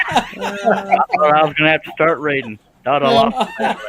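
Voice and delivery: laughing while talking. Real, not acted. Caught off guard on the stream, laughing as he speaks. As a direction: talk through a real laughing fit.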